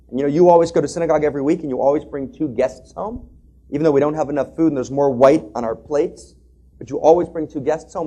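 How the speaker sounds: put-on a girl begging her father to listen; pleading daughter.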